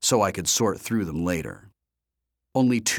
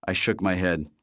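ordinary delivery, nothing marked

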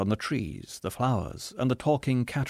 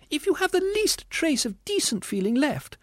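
very high indignant ghost voice; distressed squealing ghost. A narrator's reading. Ghost rebuking the spirit, squealing indignantly. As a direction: plead indignantly, far up high.

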